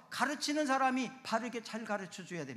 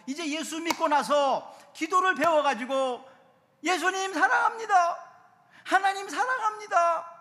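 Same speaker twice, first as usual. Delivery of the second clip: loud, high fervent cry of praise; preaching at full force; 12 semitones higher, 12 dB louder than usual. Acted. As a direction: proclaim it like a new believer's first prayer.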